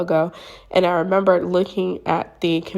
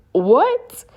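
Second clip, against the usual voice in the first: high, rising, louder what; baffled; 7 semitones higher, 5 dB louder than usual. Retold or imitated. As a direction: act out a stunned question. Acting out her baffled reaction.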